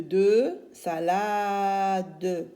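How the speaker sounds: "salade" drawn out, its final "de" fully voiced; syllables sounded out. Shown as a demonstration. Teacher building the word from syllables, "salade" stretched with final "de" sounded. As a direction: stretch "salade" into sa-laa-deu. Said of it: "d-, avec E".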